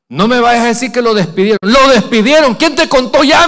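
loud and high, rushed "Lo despidieron"; excited disbelief. Retold or imitated. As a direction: blurt the news back incredulously. Excited news exchange with wife.

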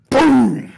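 loud, sudden, high boom; explosive boom. Acted. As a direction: slam the sound out like a wall breaking. Sudden attack sound effect.

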